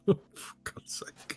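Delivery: whispered, drawn-out exasperation; exasperated under the breath. Real, not acted. Host reacting to a bad joke, exasperated whisper. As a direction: mutter it wearily to yourself, very soft.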